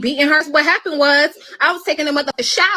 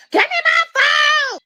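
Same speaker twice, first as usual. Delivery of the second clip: high, slow, drawn-out shout; imitated girl screaming; 12 semitones higher, much slower than usual. Little girl yelling at man taking her phone, high drawn-out scream. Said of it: "started screaming and hollering like".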